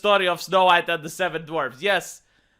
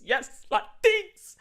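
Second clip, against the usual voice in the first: mock swooning; 12 semitones higher, much slower than usual. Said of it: "tears of joy over his face".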